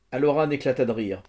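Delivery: ordinary delivery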